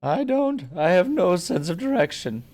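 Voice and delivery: put-on very high, loud panicky old man; panicked old guy voice. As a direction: yelp it like a frightened old hero. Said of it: "a panicked old guy voice".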